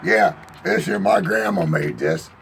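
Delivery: usual speaking voice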